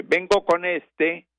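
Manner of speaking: farmer's plea. Retold or imitated. Narrator voicing the farmer at school, slow and high. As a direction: plead slowly like a humble peasant. Farmer voiced in retold fable.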